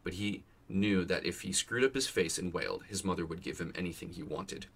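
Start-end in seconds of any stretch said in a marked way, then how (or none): none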